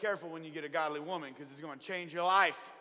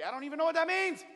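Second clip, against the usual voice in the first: much higher and louder panicked cry; panicked outburst; 12 semitones higher, 7 dB louder, faster than usual. Retold or imitated. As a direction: let the worry burst out high and loud. Panicked protest to God.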